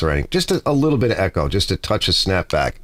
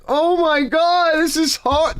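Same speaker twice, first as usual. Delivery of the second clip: high, slow whiny voice of a struggling newbie; struggling beginner; slower than usual. Retold or imitated. Playing a newbie guitarist struggling on the Bronx corner, high whine. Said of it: "And I'm like".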